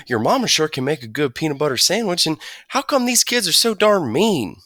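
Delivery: put-on new kid praising the sandwich, chattering on; country boy. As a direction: chatter like a friendly farm boy. Rufus voiced, yakking.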